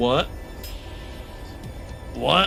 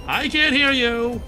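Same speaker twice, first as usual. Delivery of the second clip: loud, slow defiant shout; 6 semitones higher, 5 dB louder, slower than usual. Acted. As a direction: yell to drown them out. Covering ears against voices.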